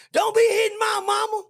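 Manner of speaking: very high, loud yell; yelling from inside. Retold or imitated. Relative in the background yelling at him to stop, very high and loud. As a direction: scream across the room to protect her. Someone yells from house.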